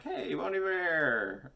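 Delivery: loud, drawn-out high call; mock holler with stretched words. Hollering for a musician while driving through town, calling out.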